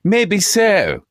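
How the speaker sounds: a cocky retort in a put-on voice; mock smug comeback. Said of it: "I like the delivery on that one".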